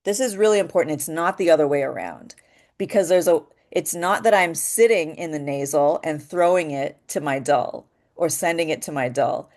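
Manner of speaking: ordinary talk, nothing marked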